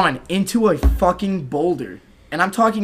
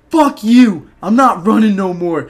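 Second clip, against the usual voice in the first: much higher, louder defiant shout; 6 semitones higher, 8 dB louder than usual. Retold or imitated. Yelling at the cop after getting up, louder. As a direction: yell it at the cop.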